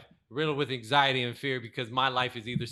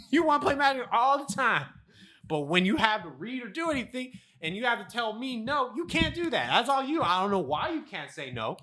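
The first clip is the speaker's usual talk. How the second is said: girlfriend voiced much higher, scolding and teasing; mock girlfriend scolding; 8 semitones higher than usual. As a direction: play her as a high-pitched teasing scold. Girlfriend Cassie voiced in story.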